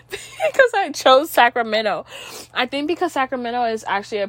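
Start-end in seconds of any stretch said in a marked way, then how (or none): 0.0-2.1 real teary laugh; laughing about crying. Real, not acted. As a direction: laugh with a tearful edge. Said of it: "I literally was crying".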